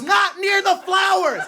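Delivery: grandma's shout. Retold or imitated. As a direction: yell it like an angry grandma. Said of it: "she comes out and she goes".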